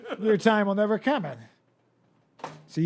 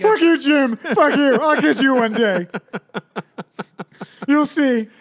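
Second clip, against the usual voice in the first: loud laughing fake fury, higher pitch; angry Mark Twain shout; 8 semitones higher, 9 dB louder than usual. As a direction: yell it like a sore loser, laughing. Twain voiced cursing at a rival.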